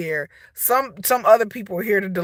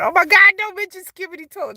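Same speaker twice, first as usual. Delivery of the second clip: high-pitched mock panic, loud then fading; imitating freaking-out kids; 10 semitones higher, 4 dB louder than usual. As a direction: plead like a freaked-out kid. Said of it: "My kids be freaking out".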